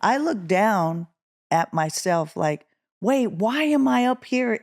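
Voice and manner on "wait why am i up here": higher-pitched startled question; puzzled, raised-pitch self-quote; bewildered. Retold or imitated. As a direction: relive the confusion of finding yourself up there. Relives out-of-body bewilderment.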